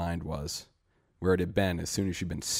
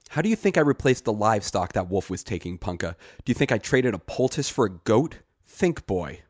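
exasperated scolding; 3 semitones higher, 6 dB louder than usual. Acted. Greet scolds Punka, exasperated.

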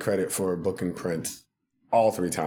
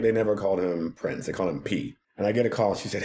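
ordinary talk, nothing marked

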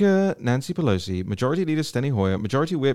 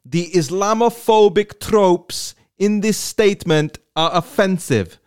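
louder, higher lilting mock Somali-style accent; Ilhan Omar accent; 5 semitones higher, 4 dB louder than usual. Host reading her tweet aloud in a mock Somali accent. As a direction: drag out the words in a put-on accent. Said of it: "I'm gonna do her accent".

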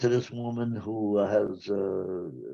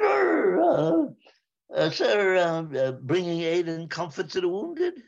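high, halting stammer of a frightened private; stammering scared private; 10 semitones higher than usual. Retold or imitated. Private caught by the regimental surgeon, stammering high in fright. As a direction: stutter it out high, caught red-handed.

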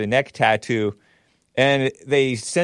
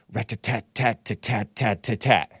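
gunfire noise; 3 dB quieter than usual. Retold or imitated. Imitates gunfire.

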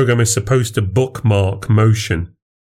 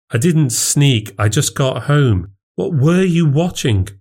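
higher, slow, insistent wife voice; wife pressing; 6 semitones higher than usual. Acted. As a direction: she presses, slowly, a bit hurt. Wife answers, presses question.

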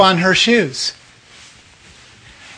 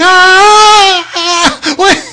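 high startled gasping cry; 11 semitones higher, 3 dB louder, much slower than usual. Retold or imitated. Teen boy startled awake by a voice, high cry. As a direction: jolt up in alarm, voice shooting up.

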